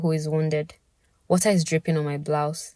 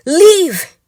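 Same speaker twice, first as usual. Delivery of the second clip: high loud scream; loud, high yell; furious shout; 12 semitones higher, 12 dB louder than usual. Acted. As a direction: throw him out. Pouring frustration on friend.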